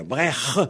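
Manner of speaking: "Brecht" said brekh, with a long rasp; Vannetais final H. Shown as a demonstration. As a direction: say "Brecht" with the throat scraped at the end. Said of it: "se prononce H en Vantais".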